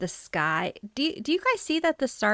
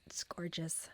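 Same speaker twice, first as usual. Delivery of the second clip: soft breathless whisper; awed whisper; 2 semitones lower, 13 dB quieter than usual. Real, not acted. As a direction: whisper it in wonder. Hushed wonder.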